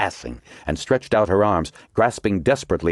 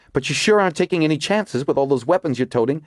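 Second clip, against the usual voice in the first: higher, urgent woman character; Margot's voice; 10 semitones higher than usual. A narrator's reading. Margot explains her theory to the agents, urgent and higher. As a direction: voice Margot pressing her discovery.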